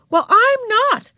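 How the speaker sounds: high, loud, cross retort; snappish. A narrator's reading. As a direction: snap back at a little sister. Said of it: "snapped Beezus".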